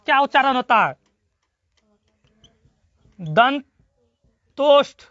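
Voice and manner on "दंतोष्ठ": दंतोष्ठ drawn out syllable by syllable; slow demo. Shown as a demonstration. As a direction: spell out दंतोष्ठ slowly for the class. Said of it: "दंतोष्ठ से अब कौन".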